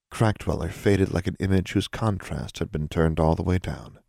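ordinary delivery

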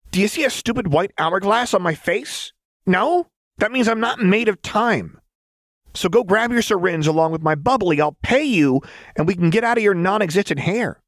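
very high, quick, impatient rant; impatient Jinx. A narrator's reading. Jinx demanding service from the doctor, high and impatient. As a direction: rattle off demands in a high character voice.

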